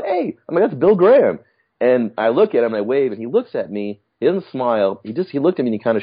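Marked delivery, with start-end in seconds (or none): 0.0-1.4 very high, eager call-out; excited recognition. Retold or imitated. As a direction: spot the famous man. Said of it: "I go".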